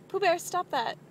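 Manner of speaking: halfway laughing reprimand of the dog; real pet scolding. Real, not acted.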